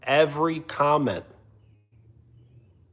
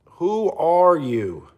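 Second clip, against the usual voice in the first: slow, drawn-out, higher challenge; scornful emphasis; 4 semitones higher, much slower than usual. Real, not acted. Streamer putting down a viewer, slow and scornful. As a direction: stretch each word of the question with disdain. Same question repeated for emphasis.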